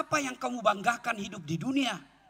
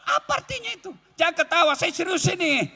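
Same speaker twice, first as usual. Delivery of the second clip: ordinary talk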